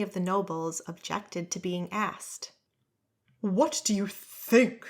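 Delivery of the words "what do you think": indignant slurred. Acted. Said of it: "indignant man say in a slurred voice".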